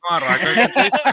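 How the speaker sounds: laughing echo of the joke. Real, not acted. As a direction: repeat it through laughter. Repite riendo. (repitiendo entre risas)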